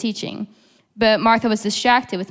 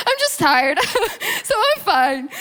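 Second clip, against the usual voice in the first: higher-pitched, giggling through the words; laughing relief; 10 semitones higher than usual. Retold or imitated. Speaker retelling her relief at just being tired, laughing.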